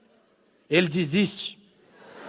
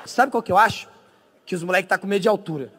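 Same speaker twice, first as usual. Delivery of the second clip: ordinary delivery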